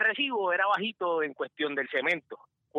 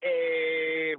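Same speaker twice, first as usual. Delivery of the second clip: usual speaking voice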